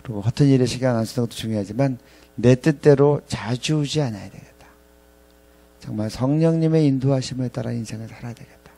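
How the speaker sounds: ordinary talk, nothing marked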